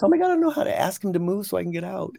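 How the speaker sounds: high, fast, panicky voicing of the young woman; panicked. Young woman trapped by the gate, high and panicky. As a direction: rush it out like you're trapped. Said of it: "she panicked because she goes".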